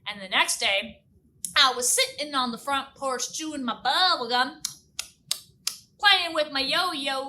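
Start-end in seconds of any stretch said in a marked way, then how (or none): none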